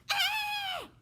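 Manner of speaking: very high loud yelp; a king shocked by the princess; shocked cry. Acted.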